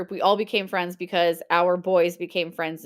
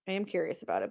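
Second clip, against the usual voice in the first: "I am curious about it" said again much softer, under the breath; softer repeat; 11 dB quieter, faster than usual. Shown as a demonstration. Host musing to herself after viewers ask, said softly. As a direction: echo it quietly to herself.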